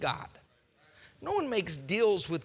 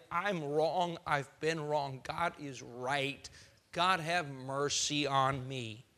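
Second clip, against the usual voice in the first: softer, lower, slow contrite prayer; humble repentance; 6 semitones lower, 4 dB quieter, slower than usual. Retold or imitated. True penitent praying humbly to God. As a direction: confess quietly and sincerely.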